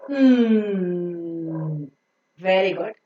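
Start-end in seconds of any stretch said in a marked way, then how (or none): 0.1-1.9 "Mm" as a very long, sinking nasal nnn; nasal N sound. Shown as a demonstration. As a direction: sustain "Mm" as N through the nose. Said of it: "Pronounce it".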